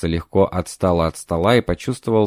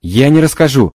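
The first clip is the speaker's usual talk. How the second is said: loud desperate refusal; 4 semitones higher, 6 dB louder than usual. Acted. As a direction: cry out you will never tell. Spirit refuses to tell.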